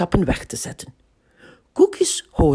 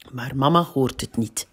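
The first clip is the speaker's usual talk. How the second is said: ordinary delivery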